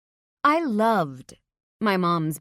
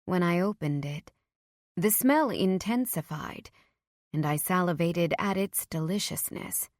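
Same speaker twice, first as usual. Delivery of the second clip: ordinary delivery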